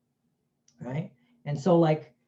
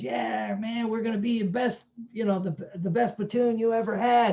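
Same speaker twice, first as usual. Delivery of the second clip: imitating a keen recruit, pitched up; mock eager recruit; 5 semitones higher than usual. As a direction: lightly act out a gung-ho boast. Cocky recruit acted in the story.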